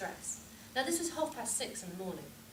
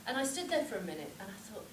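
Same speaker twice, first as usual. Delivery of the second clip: ordinary talk, nothing marked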